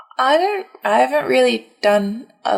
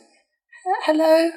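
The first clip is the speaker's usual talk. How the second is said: timid hello; 8 semitones higher, much slower than usual. Acted. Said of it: "just be like".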